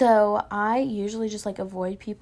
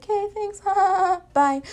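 awkward high thanks; 9 semitones higher, 5 dB louder than usual. Retold or imitated. Awkward thanks to cute guy.

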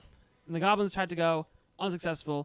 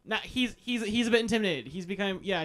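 ordinary talk, nothing marked

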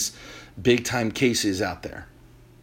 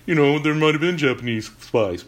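dismissive skeptic. Retold or imitated. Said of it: "You're like".

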